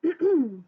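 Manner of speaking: loud attention-getting throat clear. Acted. Teacher calling class to attention, throat clear. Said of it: "cleared her throat".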